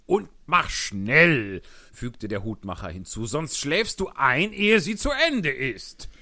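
loud, excited Hatter voice. Acted. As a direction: tell it like an agitated Hatter. Hutmacher als Figur. (lauter, aufgeregter Hutmacher)

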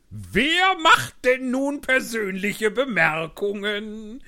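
loud, high, slow gloating retort; triumphant Hatter. Acted. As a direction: crow it in triumph. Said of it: "rief der Hutmacher triumphierend". (triumphierend, laut und hoch)

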